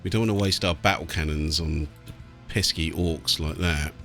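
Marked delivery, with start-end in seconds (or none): none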